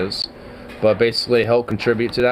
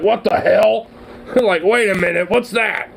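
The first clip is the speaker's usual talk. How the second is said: high startled squirrel, much higher; 10 semitones higher than usual. Retold or imitated. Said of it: "tree and went, like".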